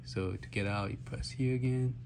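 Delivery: ordinary talk, nothing marked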